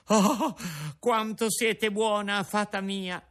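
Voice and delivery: put-on high, grateful boy's plea; grateful puppet boy; tearful puppet. A narrator's reading. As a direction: thank the fairy through drying tears. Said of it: "disse il burattino, asciugandosi gli occhi".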